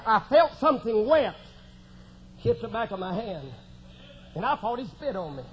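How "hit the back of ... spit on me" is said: ordinary talk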